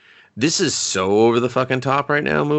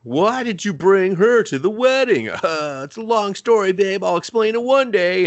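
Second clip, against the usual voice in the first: higher, louder mock voices; mock wedding couple; 9 semitones higher, 3 dB louder than usual. Mocking the bride and groom's exchange, hammed-up high. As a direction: ham up the awkward couple. Mock wife and husband dialogue.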